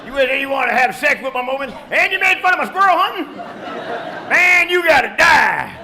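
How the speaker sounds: loud, rising mock outrage; mock angry Kevin. Comic voicing the upset audience member, hammed-up fury. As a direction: play the jealous guy losing it. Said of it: "Kevin's really upset with me now".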